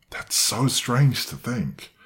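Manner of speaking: ordinary talk, nothing marked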